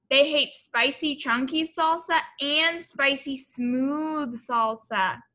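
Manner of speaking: ordinary talk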